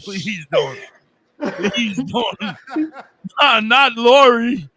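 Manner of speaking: high pleading through laughter; laughing plea. Real, not acted. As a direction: beg while laughing. Laughing plea about Lori.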